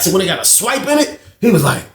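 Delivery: ordinary talk, nothing marked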